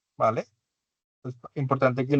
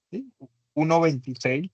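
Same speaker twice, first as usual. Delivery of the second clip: ordinary delivery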